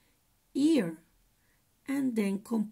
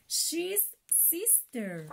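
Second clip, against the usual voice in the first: slow model word; 5 semitones higher, 6 dB quieter, slower than usual. Shown as a demonstration. Teacher models a word for kids to copy, slowly. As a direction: say it slowly for the class. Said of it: "practiquemos un poco cómo deletrear".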